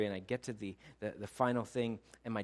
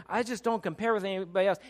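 insecure, higher-pitched insistence; raised pitch, unconvinced claim; 8 semitones higher, 6 dB louder than usual. Shown as a demonstration. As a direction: say it as an insecure protest. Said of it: "There's the insecure".

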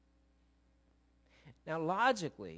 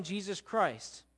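usual speaking voice

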